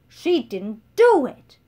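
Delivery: defiant shout. Acted. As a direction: hammer each word in Sophie's defence. Beatrice shouts defending Sophie.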